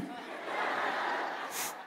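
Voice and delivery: breathy quiet chuckle; soft laugh. Real, not acted. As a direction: laugh softly to yourself. Laughs at the crowd.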